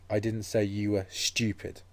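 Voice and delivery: slow build to a set-off STUPID; stress on STUPID. Shown as a demonstration. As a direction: hold back, then land firmly on STUPID. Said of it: "put the stress into different places".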